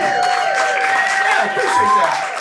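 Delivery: a jokey falsetto thank-you to the crowd; mock-shy falsetto gratitude; high squeaky thanks. Real, not acted. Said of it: "thank you".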